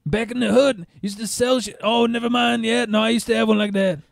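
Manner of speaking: high little raspy impression; Pinky. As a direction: imitate an excited pimp, high all through. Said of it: "I'm picturing that guy's voice".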